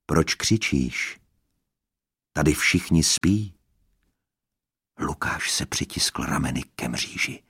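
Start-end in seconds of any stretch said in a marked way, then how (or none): 0.1-3.5 dark woman's voice. Acted. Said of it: "ten ženský hlas, temný".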